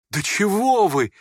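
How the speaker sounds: high, drawn-out startled question; terribly frightened. A narrator's reading. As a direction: recoil with alarm, stretching the words. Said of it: "испугался ужасно Коля". (испуганный возглас мальчика)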